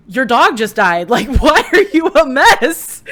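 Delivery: loud mock bewilderment, pitch climbing into a laugh; imitated husband. Husband asking why she came home crying, mock-baffled. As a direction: play a baffled husband, lightly exaggerated. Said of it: "And he's like".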